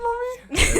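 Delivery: very high, loud squeal; squeaky kid voice. Retold or imitated. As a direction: squeak it like a goofy toddler. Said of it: "our buck teeth and our glasses and we go".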